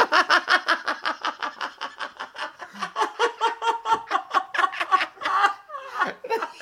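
high, helpless laughter; real laughter that runs on and on; long laughing fit. Real, not acted. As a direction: burst out laughing and keep it going. Joke about the pool, hosts laughing.

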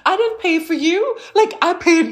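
high, laughing indignation; indignant. Retold or imitated. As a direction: protest a freeloader, amused. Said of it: "You're like".

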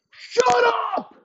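very high, loud screamed shut up. Acted. Elway shrieks at the ball boy at the door.

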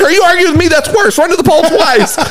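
high-pitched mock exasperation; imitated scolding coach. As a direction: tell off a player who talks back, playfully acted. Coach scolds player in story.

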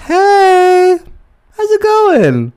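high mocking imitation of the guy. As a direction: squeak it out like a mocking caricature. Mocking the guy's text.

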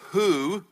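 "Who" pitched high, loud and held; stress on Who. Shown as a demonstration. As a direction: make "Who" ring out as the key word. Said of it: "I'll emphasize the words".

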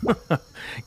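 breathy mercenary snicker; mocking unvoiced snicker. Mercenary snickering at the would-be paladin. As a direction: snicker at the naive hero.

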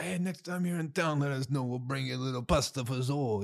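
put-on light New Jersey Italian accent, friendly; hint of a warm Jersey grandma offering pasta. Vlogger voicing welcoming New Jersey locals, light Jersey accent. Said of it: "They're like".

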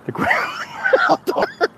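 helpless laughter swallowing the words; slow, high, squeezed out through real laughter; laughing. Real, not acted. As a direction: barely get it out for laughing. Cracks up over bad acting.

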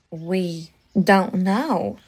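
low, slow southern belle voice; southern accent. Acted. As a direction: drawl it like a southern lady. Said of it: "Her southern accent".

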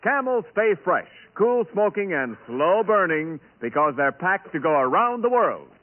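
ordinary delivery

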